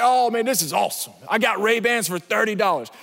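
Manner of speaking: normal talking voice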